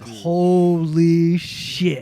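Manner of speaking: slow, drawn-out stunned exclamation; awed. Retold or imitated. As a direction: let the shock stretch out. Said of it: "And I was like".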